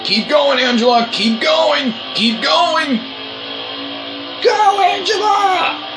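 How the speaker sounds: high, urgent cheering on; excited cheering. Real, not acted. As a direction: cheer her on to the line. Cheering teammate in race finish.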